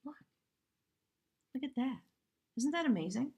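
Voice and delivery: ordinary talk, nothing marked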